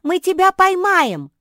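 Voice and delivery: high, loud excited promise; eager assurance. A narrator's reading. Жози promising to catch Марсия, loud eager assurance. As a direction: call up loudly to convince. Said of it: "уверяла Жози".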